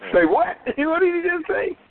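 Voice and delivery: real high-pitched laughing disbelief; laughing, voice jumping up high; incredulous laughter. Real, not acted. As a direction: laugh through a stunned question.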